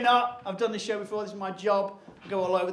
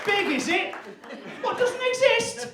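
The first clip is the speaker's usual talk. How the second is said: high, raised-voice jeer; mock outraged taunt; 12 semitones higher, 3 dB louder, slower than usual. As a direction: push the taunt up high and loud. Taunting comeback in monkey forest argument.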